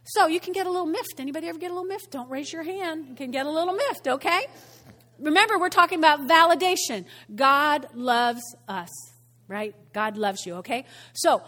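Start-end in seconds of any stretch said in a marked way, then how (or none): none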